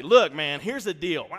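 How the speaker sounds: loud, stern barked order; drill sergeant. Retold or imitated. Dad going drill sergeant on son, loud stern order. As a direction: lay down the law like a drill sergeant. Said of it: "I went drill sergeant mode".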